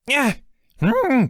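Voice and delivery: very high, loud panicked gnome yelp; blurting gnome. Acted. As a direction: burst in blurting like a frantic gnome. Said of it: "the gnome blurted".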